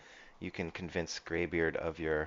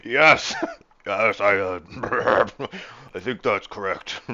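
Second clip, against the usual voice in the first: loud, blustering start, then settling; hammed-up sputtering agreement; spluttering captain; 4 semitones higher, 10 dB louder than usual. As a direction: splutter through it as a stumbling captain. Captain splutters, stumbles, spittle.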